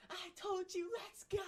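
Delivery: very high-pitched fan scream; high, strained cheering; retold screaming. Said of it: "I was screaming out of my lungs".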